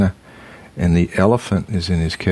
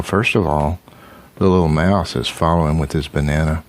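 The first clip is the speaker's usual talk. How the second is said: usual speaking voice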